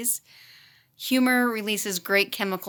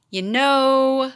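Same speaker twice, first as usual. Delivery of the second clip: loud, higher, drawn-out know; knowing teasing; 5 semitones higher, 8 dB louder than usual. Retold or imitated. Fourth grade teacher confronting her about rumors, mock knowing. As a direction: stretch it out like a teasing colleague.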